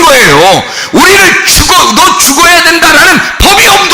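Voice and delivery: fervent sermon, half shouted; preaching at full force. Acted. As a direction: drive each point home to the pews. Preaching to the congregation.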